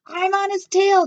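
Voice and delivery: high, loud declaration; teacher vowing to catch him; loud determined declaration; declared. A narrator's reading. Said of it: "declared Missus Weatherby".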